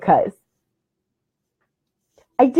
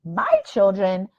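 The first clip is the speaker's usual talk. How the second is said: normal talking voice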